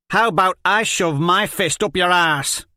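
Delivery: loud, high furious comeback; angry retort. Acted. Liz Hen hits back at Jenko, loud furious retort. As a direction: bite back hard.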